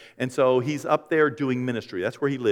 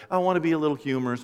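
normal talking voice

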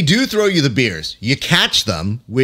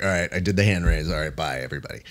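lower, softer, fast mumbled goodbye fading out; mumbled exit; 8 semitones lower, 7 dB quieter, much faster than usual. Retold or imitated. Opponent slinking off the mat, mumbling a quick goodbye. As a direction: slink off the mat muttering bye to everyone. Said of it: "be like".

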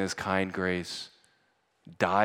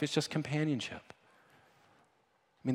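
ordinary talk

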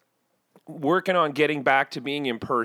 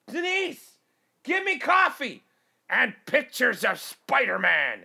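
much higher, pompous boss ordering his secretary; high bossy order to Denise; bossing the assistant; 7 semitones higher, slower than usual. Pretend. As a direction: demand it like a fifties executive. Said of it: "pretend ... assistant named Denise".

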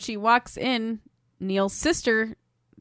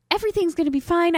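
mock reassurance; 8 semitones higher, faster than usual. Boyfriend reassuring, voiced.

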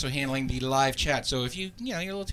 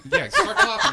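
laughing; 9 dB louder than usual. Real, not acted. Laughing, joking about cold.

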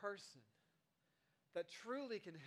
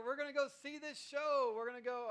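higher, louder, upbeat; excited parent; 6 semitones higher, 7 dB louder than usual. Retold or imitated. Parent announcing a show to kids, excited. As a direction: sell the outing to the kids.

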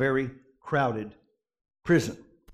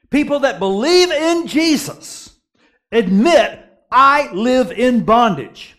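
high, loud and slow; fervent preaching; 7 semitones higher, 6 dB louder than usual. Acted. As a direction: press it home to the church. Preaching climax.

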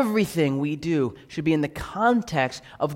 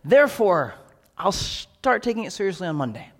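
mock breezy excuse; 6 semitones higher, 3 dB louder than usual. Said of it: "there's this idea that".

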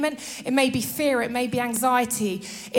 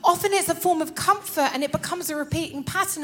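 ordinary delivery

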